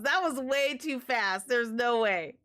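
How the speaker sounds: normal talking voice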